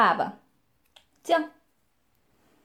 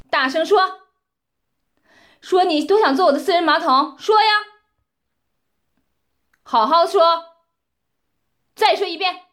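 loud, higher demanding shout; commanding shout; 5 semitones higher, 8 dB louder than usual. Acted. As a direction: yell the demand at him. Demanding he say it louder.

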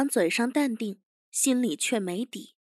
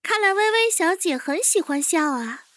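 higher-pitched, pointed remark; higher voice, sarcastic observation; needling young chief; 7 semitones higher than usual. Acted. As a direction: needle the captive in a young boss's voice. Chief needles the sneering captive.

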